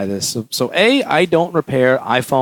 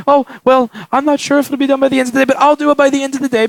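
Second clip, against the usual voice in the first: high, fast mock placating; hurried, higher-pitched wishy-washy promise; caving to whining; 10 semitones higher, faster than usual. Repairer caving to a whining customer, high rushed false promise. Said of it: "somebody starts complaining and whining".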